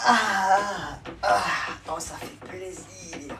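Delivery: high, very slow hammed-up moaning; mock wailing.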